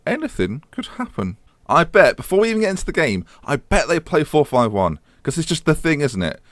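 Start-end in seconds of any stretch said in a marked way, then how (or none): 0.1-1.4 hushed teasing. Shown as a demonstration. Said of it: "repeat of anything could happen".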